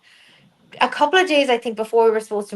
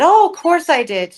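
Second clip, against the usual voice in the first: higher, louder mock indignation; emphatic retold reassurance; 7 semitones higher, 5 dB louder than usual. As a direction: imitate an emphatic "obviously". Husband retold reassuring his wife.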